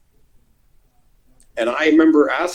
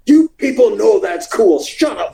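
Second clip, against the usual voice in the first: high, exasperated retold scolding; mad at audience; 7 semitones higher, slower than usual. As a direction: act out snapping at an unresponsive crowd. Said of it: "getting so mad at the audience".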